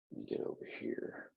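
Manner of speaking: ordinary delivery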